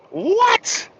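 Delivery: sudden high squeak of disbelief; incredulous what. Real, not acted. Ranting at the tow guy over the fee, a squeaky outraged what. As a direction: shoot the pitch way up, outraged. Outraged at fifteen-hundred charge.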